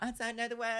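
light, high, soft diva imitation. Imitating a star on stage, lightly airy and high. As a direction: toss it off high and softly, lightly mimicking a star. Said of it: "[name] can go on stage and be like".

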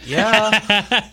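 high, drawn-out mock reply; slow, high put-on guard voice; guard's reply. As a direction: stretch it out high, as the gullible guard. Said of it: "he's like".

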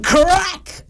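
high, sharp crack of breaking wood; sudden crack. A narrator's reading. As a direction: make the chair snap with your voice. Sudden crash in the story.

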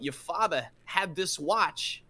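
slow, halting delivery; Christopher Walken. Acted. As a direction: drag it out with odd high jumps. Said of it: "he just talks about".

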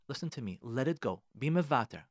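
usual speaking voice

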